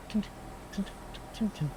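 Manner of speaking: high soft tink, tink, tink; tinkling glass sounds. Shown as a demonstration. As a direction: mimic glass tinkling softly. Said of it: "Almost like the sounds of glass falling".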